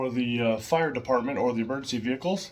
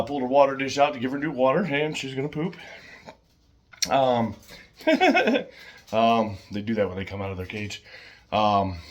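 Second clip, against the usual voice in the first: normal talking voice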